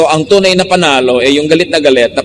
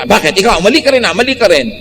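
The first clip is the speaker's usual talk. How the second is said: angry retort; 7 semitones higher, faster than usual. Retold or imitated. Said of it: "Pero kung siya".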